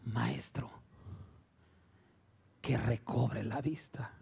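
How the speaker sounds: slow, hushed whisper; breath-only pleading; whispered blind man's plea. Acted. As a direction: whisper the request reverently. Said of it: "Y el ciego le dijo".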